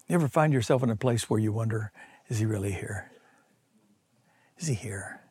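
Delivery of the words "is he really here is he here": much softer, lonely wondering; doubtful inner question. Retold or imitated. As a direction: ask it quietly, almost to yourself.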